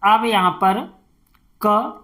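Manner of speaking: ordinary delivery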